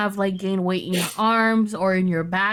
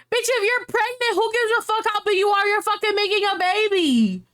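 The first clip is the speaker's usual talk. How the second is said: much higher, fast exasperated rant; indignant outburst; 9 semitones higher than usual. Real, not acted. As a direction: fire it off in disbelief. Indignant defence of pregnant women.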